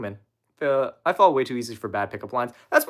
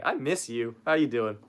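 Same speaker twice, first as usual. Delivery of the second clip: ordinary talk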